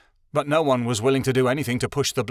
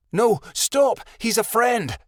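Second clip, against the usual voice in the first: high, loud desperate shout; 11 semitones higher, 4 dB louder than usual. A narrator's reading. Snapfoot stopping the creatures from attacking his friend, shouting high. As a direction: yell to stop the attack. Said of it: "Snapfoot shouted".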